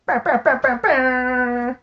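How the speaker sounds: high, sung-out horn imitation; mock fanfare. Host celebrates viewers in the chat with a mock fanfare. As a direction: trumpet it out, holding the last note.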